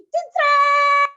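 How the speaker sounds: a playful fanfare noise when handing over the food; ta-da sound. Retold or imitated. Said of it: "pas saya kasih".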